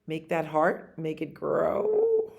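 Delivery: plain reading voice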